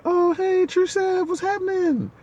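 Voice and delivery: very high, loud party welcome; squealing drunk coworkers hyping him up; hyped-up greeting. Retold or imitated. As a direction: call out like tipsy friends cheering.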